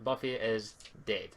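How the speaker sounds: ordinary talk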